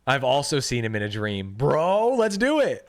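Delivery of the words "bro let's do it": loud, rising hype; excited urging. Real, not acted. Streamer eager to share dreams with game character, excited urging. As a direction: egg them on, pitch climbing.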